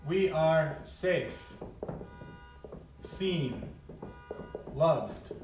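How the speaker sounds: slow, soft and reassuring; calm, reassuring fish voice. Acted. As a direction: soothe like a calm inner voice. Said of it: "And this one sees".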